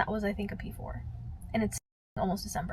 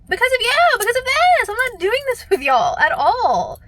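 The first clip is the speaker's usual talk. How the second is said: much higher, louder mock outburst; retold yell; 11 semitones higher, 8 dB louder than usual. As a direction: blow up at him in a retold rant.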